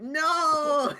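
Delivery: high shout breaking into laughter; a laughing retold no; laughing mock cry. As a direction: wail the no, then crack up laughing. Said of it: "And I said".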